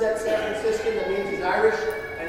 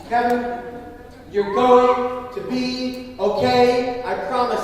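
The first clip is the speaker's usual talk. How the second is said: slow, drawn-out gentle reassurance; a tender father's slow promise; 4 semitones higher, 5 dB louder, slower than usual. Retold or imitated. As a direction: soothe your hurt son. Father comforting injured son.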